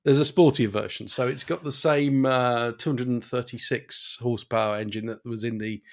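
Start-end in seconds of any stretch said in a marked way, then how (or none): 0.0-1.1 raised, louder voice; louder defensive. Real, not acted. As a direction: push back, louder and higher. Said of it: "You're pausing a lot".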